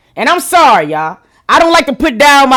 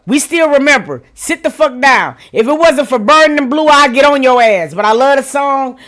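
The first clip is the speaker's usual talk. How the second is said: usual speaking voice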